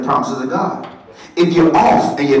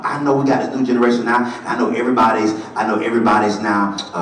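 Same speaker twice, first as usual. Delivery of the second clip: ordinary delivery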